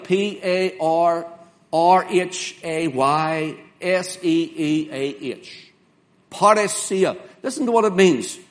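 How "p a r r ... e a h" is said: P A R spelled slowly letter by letter; spelling out letters. Shown as a demonstration. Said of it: "The word here for confidence is a word".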